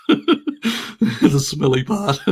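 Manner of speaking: drawn-out words through laughter; giggling at own crude joke; laughing. Real, not acted. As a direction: laugh as you say it.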